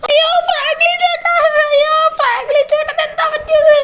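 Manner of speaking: put-on high, wailing child voice; a little boy crying out in alarm; upset child. Child hears of brother's accident. (ഉയർന്ന കുട്ടിസ്വരത്തിൽ നിലവിളി)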